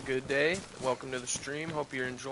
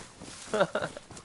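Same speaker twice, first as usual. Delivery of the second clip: breathy laugh; 7 semitones higher than usual. Real, not acted. Laughs after singing.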